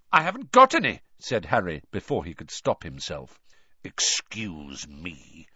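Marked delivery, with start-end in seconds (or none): 0.1-1.0 higher, louder blurt. A narrator's reading. Harry blurts to Moody, higher and louder. 3.8-5.5 low, slow growl. A narrator's reading. Moody rebukes Harry, slow low growl. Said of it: "growled Moody".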